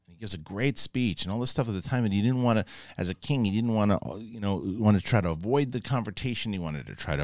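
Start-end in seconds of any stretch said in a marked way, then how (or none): none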